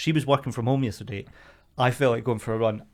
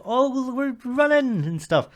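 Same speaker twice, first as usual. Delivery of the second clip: mock girlfriend voice; 11 semitones higher, slower than usual. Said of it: "And like".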